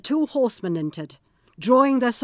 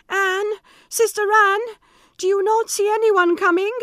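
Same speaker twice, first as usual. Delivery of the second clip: high, loud calling upward; anxious call; 12 semitones higher, 5 dB louder than usual. Acted. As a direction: call up the tower desperately. Wife calls again.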